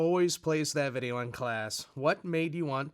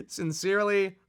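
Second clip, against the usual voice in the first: laughing; 5 semitones higher, 5 dB louder than usual. Real, not acted. Reading letter sign-off, laughing.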